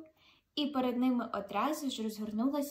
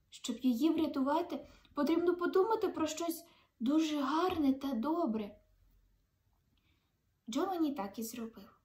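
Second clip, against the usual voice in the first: usual speaking voice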